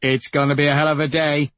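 eager leader relishing the mission; gleeful anticipation. Acted. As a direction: rush it out with wild glee.

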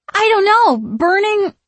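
loud, high flustered witch voice. A narrator's reading. Sisters talking over one another, loud and flustered. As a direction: blurt it high and confused.

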